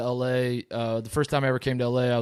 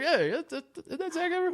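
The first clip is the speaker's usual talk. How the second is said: higher and softer, an awed double take; starstruck surprise; 14 semitones higher, 5 dB quieter than usual. Retold or imitated. Young guy at an L A dinner spotting a movie star, awed.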